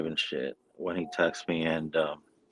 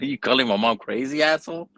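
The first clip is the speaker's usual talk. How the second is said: rising, indignant retort; mock outrage; 8 semitones higher than usual. Snapping back at his brother, high and indignant. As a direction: pitch climbing in offended disbelief. Defending mom against brother.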